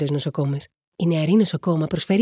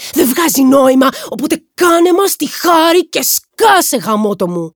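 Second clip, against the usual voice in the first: loud, high-pitched angry tirade; exasperated shouting; 9 semitones higher, 9 dB louder than usual. A narrator's reading. Aaron keeps yelling at Cliff. (αγανακτισμένες φωνές, δυνατά και ψηλά)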